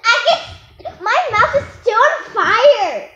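laughing through the words. Real, not acted. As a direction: laugh while asking for relief. Laughing after spicy bite.

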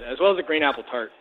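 ordinary delivery

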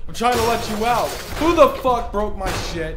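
loud, high frustrated shouting; angry yelling. Real, not acted. Player yells at the house spirit, angry and loud. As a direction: yell it like your stuff got wrecked.

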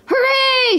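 very high, drawn-out cheer; joyful shout. A narrator's reading. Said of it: "shouted Scrooge".

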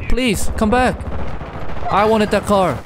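pleading shout. Real, not acted. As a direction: yell after them, begging. Pleading, car driven away.

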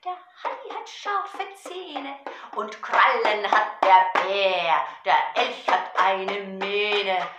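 loud, drawn-out rhythmic recitation; Gedichtvortrag. Shown as a demonstration. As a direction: declaim each animal with weight on the beat. Recites poem with drum. (laut und gedehnt vorgetragenes Gedicht)

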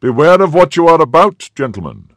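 loud, high call, dropping on the last word; clear commanding voice. A narrator's reading. Officer warns the musketeers, clear and commanding. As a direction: command them with a clear ringing warning. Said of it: "clear voice which seemed accustomed to command".

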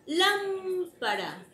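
"Lámpara" lenta y aguda, golpe en "Lám"; acento en LÁM. Shown as a demonstration. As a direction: estirar "Lámpara" marcando la primera A. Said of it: "¿Y dónde será la tilde?". ("Lámpara" con la sílaba tónica resaltada)

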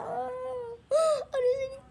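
sharp high gasp with a cry; dramatic gasp. Acted. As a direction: gasp in mock shock. Dramatic gasp at a classroom fight. (yüksek, dramatik bir nefes çekme)

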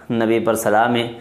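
ordinary delivery

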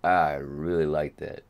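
usual speaking voice

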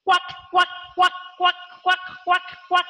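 short, even quacks; duck quacking. Shown as a demonstration. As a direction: imitate a duck in a steady string. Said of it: "like a duck".

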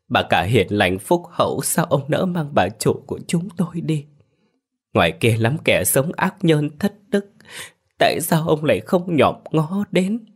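high, rising wail of reproach at heaven; tearful reproach. A narrator's reading. Servants reproach heaven for taking their mistress, high tearful wail. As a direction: cry out accusingly to the sky. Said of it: "khóc lóc mãi, mắm nấc nghẹn đấm vào lòng ngực, trách móc".

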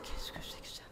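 breathless whisper of fear; scared whisper. Real, not acted. Player whispering in fear at something strange in the game. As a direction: whisper it, frightened.

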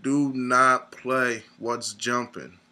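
"do not play What's Jumping" spelled out slowly, each word hit; slow, emphatic warning. Acted. Warning fellow gym-goers away from the song, slow and emphatic. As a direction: drag out the warning like a public service announcement. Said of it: "I repeat".

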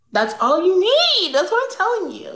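high, sassy know-it-all. Acted. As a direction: talk down to him like it's obvious. Spoiled girl lecturing interviewer.

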